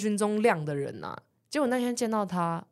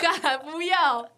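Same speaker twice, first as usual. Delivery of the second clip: laughing; 9 semitones higher, much slower than usual. Real, not acted. Laughing refusal of joke.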